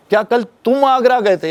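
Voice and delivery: single out तुम, as opposed to anyone else; stress on तुम. Shown as a demonstration. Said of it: "तुम्हारी जगह कोई और भी जा सकता था".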